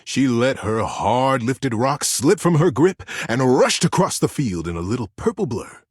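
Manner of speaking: ordinary delivery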